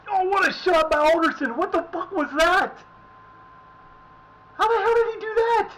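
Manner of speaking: loud, high shout of disbelief at the goal; amazed yell. Real, not acted. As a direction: cheer like you can't believe that shot. Reacting to a great goal.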